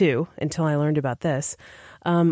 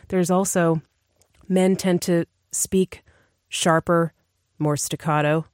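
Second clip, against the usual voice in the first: ordinary talk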